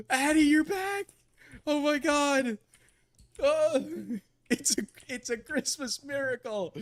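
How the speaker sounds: high, loud, laughing cry of delight; overjoyed. Real, not acted. Streamer greets Addie's return as a miracle, overjoyed. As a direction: exclaim it as if overwhelmed.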